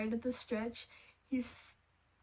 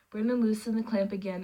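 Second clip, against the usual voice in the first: ordinary delivery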